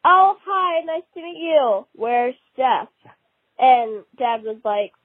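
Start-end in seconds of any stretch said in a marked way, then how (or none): 0.0-2.9 eager soccer mom. Retold or imitated. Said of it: "there was this mom, and she was like".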